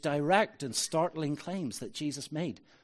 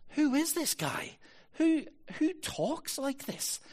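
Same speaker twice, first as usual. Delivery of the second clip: high-pitched, slowing disbelief; bewildered, much higher voice; incredulous reaction; 12 semitones higher than usual. Retold or imitated. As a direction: act out a baffled reader's thought. Acted bewildered thought on reading Gospels.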